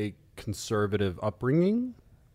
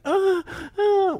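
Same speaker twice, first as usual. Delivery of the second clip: much higher, squeaky-pitched "uh, uh"; high mock moans; 4 dB louder than usual. Posing dream-guy question to co-host, high mock moans. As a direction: imitate a lover's moans way up high. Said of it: "automatically went high".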